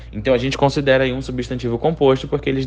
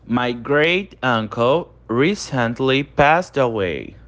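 slow model reading; much slower than usual. Shown as a demonstration. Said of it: "Então, meu tio avô recentemente faleceu".

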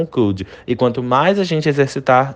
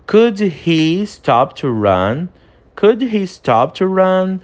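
slow and higher, "Could" stressed; slow English model sentence; 6 semitones higher, much slower than usual. Shown as a demonstration. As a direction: model it slowly, clearly. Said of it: "o terceiro exemplo". (pergunta em inglês lenta e aguda)

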